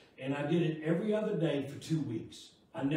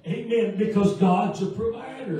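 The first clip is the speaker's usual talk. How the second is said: loud, impassioned pulpit delivery; full-force preaching; 5 semitones higher, 9 dB louder than usual. Real, not acted. Preacher testifies to the congregation how God provides, loud fervent preaching. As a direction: preach it out loud, driving toward each amen.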